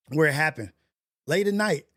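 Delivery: ordinary talk, nothing marked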